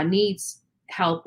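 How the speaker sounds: usual speaking voice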